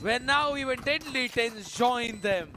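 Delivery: high, slow climactic call; esports caster. Acted. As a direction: build the final kill call. Round closing call.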